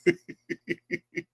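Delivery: high, wheezy fit of laughter; helpless laugh. Real, not acted. Host cracking up at their own show, helpless laughter.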